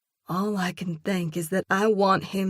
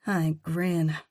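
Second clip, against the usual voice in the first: plain reading voice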